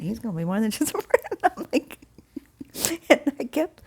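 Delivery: words breaking up into high laughter; laughing. Real, not acted. Woman retelling God's teasing about her friend, laughing. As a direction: crack up while talking, real laugh.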